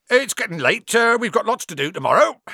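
high, loud change of subject; said loudly. A narrator's reading. Hagrid dodging Harry's expulsion question, loudly. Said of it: "said Hagrid loudly".

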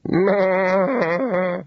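high, long imitated bleat; high wavering baa; sheep bleat. A narrator's reading. Sheep being sheared answers.

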